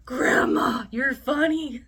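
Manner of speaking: loud, laughing "Grandma, you're funny"; amused exclaim. Retold or imitated. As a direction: call it out delighted. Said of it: "we're like".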